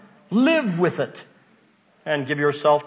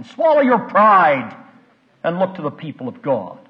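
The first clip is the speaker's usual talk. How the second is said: loud, slow preacher's command; drawn-out pulpit command; forceful exhortation; 6 dB louder than usual. Real, not acted. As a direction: hammer each word out slowly and loudly.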